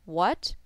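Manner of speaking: what lifted like a question; questioning rise on what. Shown as a demonstration. Teacher likens the tone to a question, rising what. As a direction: ask what with an upward slide. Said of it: "imagine you're asking the question".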